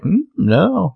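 high, drawn-out mock-innocent denial; feigned innocence. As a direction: play dumb with a high-pitched lilt. Liars lying to her face.

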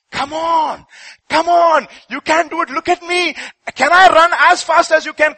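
high, loud cheering voice; snail cheering. Acted. Snail cheering on the tired rabbit, high and loud. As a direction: cheer him on like an eager snail.

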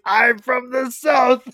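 hammed-up indignant alien insisting he's from the South; mock infuriated. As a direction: bristle at the insult, drawing it out. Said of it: "He gets, he gets infuriated".

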